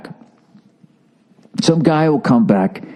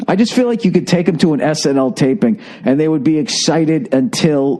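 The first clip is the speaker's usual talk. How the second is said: ordinary talk, nothing marked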